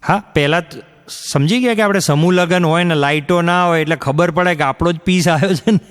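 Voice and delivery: ordinary talk, nothing marked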